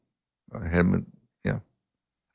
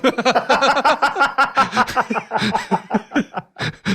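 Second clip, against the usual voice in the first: real burst of high, loud laughter; long hearty laugh; 4 dB louder than usual. Real, not acted. Storyteller cracking up at his own backfired lesson, long real laugh.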